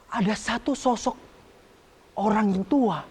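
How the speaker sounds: plain reading voice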